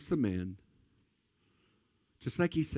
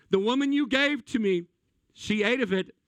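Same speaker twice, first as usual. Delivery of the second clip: Adam's mock whine, much higher and louder; whiny excuse; 9 semitones higher, 3 dB louder than usual. Mocking Adam blaming the woman to God, high whine. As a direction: pass the blame in a high, petulant whine. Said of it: "here's the great husband".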